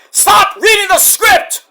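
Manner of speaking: loud, high angry shout; yelled order. Retold or imitated. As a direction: yell it at the commentator, fed up. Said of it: "I'm like".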